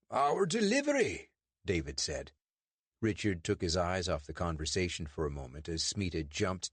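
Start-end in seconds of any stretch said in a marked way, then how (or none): 0.1-1.3 David's put-on British accent, pitched high. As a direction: fake a polite British accent to fool a constable.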